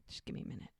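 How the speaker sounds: whispered aside. Retold or imitated. Brady quietly telling Bree to wait, in a whisper. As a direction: drop to a secret whisper.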